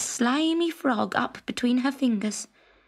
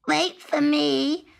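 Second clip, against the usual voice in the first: croaking frog; 5 semitones higher, slower than usual. A narrator's reading. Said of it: "croaked the green frog".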